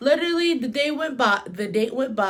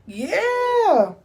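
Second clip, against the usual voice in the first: exaggerated yeah; 11 semitones higher, 7 dB louder than usual. Dazed reply retold as a joke.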